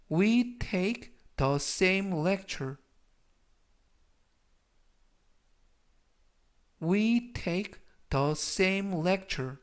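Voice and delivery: very slow, careful sentence for learners; slow model reading. Shown as a demonstration. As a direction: say it slowly so they can repeat. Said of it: "뜻이 되겠습니다". (천천히 또박또박 읽는 예문)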